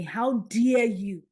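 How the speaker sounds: retold stern rebuke. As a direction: scold with weight on each word. God rebuking her in a dream.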